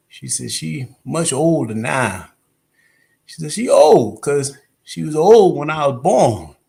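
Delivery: slow Southern drawl, stretched words; comedian's Southern church-lady tale; drawn-out Southern accent. Acted. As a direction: draw out the Southern vowels. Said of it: "drawn Southern accent".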